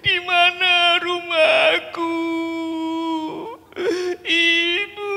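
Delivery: high, slow, plaintive little voice; sad lost bear. Acted. Lost bear cub crying for his mother, sad high voice. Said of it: "Dan beruang itu sedih".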